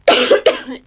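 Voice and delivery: a harsh cough mid-sentence; coughing fit. Real, not acted. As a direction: cough roughly, then carry on. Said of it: "I'm sorry for coughing".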